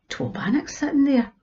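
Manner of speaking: whisper. A narrator's reading. Old man spots bannocks, hushed.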